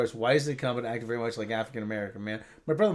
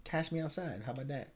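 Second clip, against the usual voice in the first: soft, understated catchphrase imitation; catchphrase echo; 11 dB quieter than usual. Quietly echoing the rapper's catchphrase.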